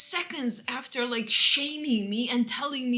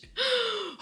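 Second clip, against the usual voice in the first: sharp gasp; 12 semitones higher than usual. Real, not acted. Shocked gasp mid-story.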